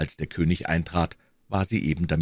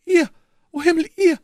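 high, loud queenly exclamation; startled cry; 8 dB louder than usual. A narrator's reading. Startled queen cries out at her servant's offer, high and loud. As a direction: cry out in astonished alarm. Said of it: "rief die Königin".

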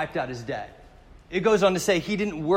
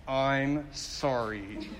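grudging sorry; 5 dB quieter, much slower than usual. Retold or imitated. Mocking grudging apology.

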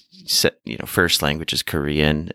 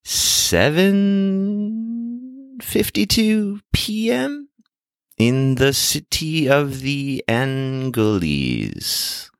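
slow, drawn-out late-night radio host opening; amped-up radio intro; 7 semitones higher, slower than usual. Pretend. Host opening the podcast from Seoul, amped-up radio intro. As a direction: stretch the place and time, climbing high. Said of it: "maybe you kinda amped it up a little bit".

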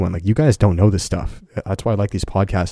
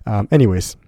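usual speaking voice

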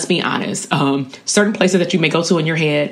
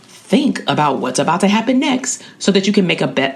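ordinary talk, nothing marked

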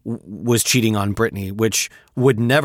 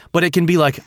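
ordinary talk, nothing marked

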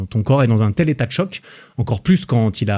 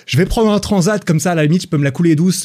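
lightly voiced runner; 7 semitones higher than usual. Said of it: "il s'est dit".

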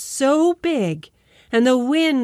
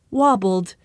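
plain reading voice

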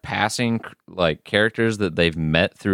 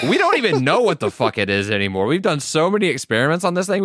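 higher, fast, careless put-on voice; mock scientists; 8 semitones higher, faster than usual. Scientists shrug off their experiment, fast put-on voice. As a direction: rattle it off like mad scientists. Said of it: "And they're like".

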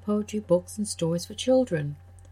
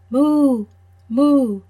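loud, high playful moo; mooing cow; 5 semitones higher, 10 dB louder than usual. Acted. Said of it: "The cow said".

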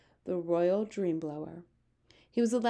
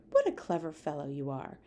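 lightly voiced, lower regal queen; 4 semitones lower than usual. Queen praising the BFG's clever plan. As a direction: praise him graciously, like a queen.

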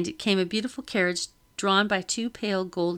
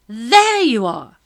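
loud, high, drawn-out exclamation; Madame's loud, slow cry of discovery; exclaiming; 5 semitones higher, 11 dB louder, slower than usual. A narrator's reading. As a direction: exclaim it loudly, stretching the words. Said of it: "exclaimed Madame Lockton".

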